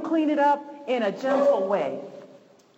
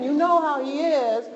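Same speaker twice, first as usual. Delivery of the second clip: Joyce Meyer-like preacher; 3 semitones higher, 5 dB louder than usual. Acted. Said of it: "You sound like Joyce Myers".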